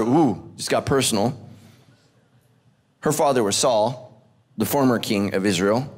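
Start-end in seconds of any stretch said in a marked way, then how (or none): none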